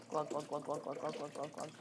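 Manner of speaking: softly voiced pouring noise, fading; glug sound effect. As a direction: mimic liquid glugging out. Imitating water pouring out.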